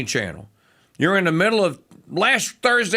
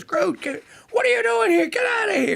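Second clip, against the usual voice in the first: high, mock shout shooing someone away; pitched-up mock yell, get out of here; Zoe Saldana yelling. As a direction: imitate an alarmed heroine shouting at a kid. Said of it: "looks at him and goes".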